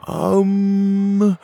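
long, high-pitched held um; loud, puzzled filler; stumped. Acted. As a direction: stall with a drawn-out high hum. Petey stuck for an answer.

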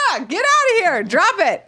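scolding. Retold or imitated. Owners scolding the cat.

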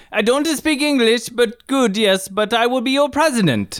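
high hammed-up Italian accent; over-the-top Italian accent in broken English. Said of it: "Italian tiebreaker".

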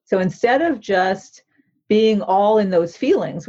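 usual speaking voice

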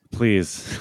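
ordinary talk

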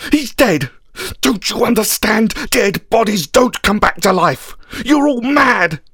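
high, strained angry outburst; distraught, high-pitched protest; screaming in anguish. A narrator's reading. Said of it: "I screamed".

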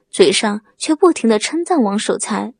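plain reading voice

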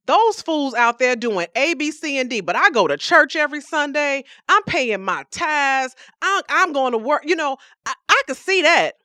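self-righteous boast. Retold or imitated. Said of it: "how often do we say".

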